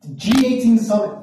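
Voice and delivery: high-pitched, combative rant; acted-out tirade at the cops; full confrontation mode. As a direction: launch into an outraged lecture, pitch way up. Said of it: "this is when I go full".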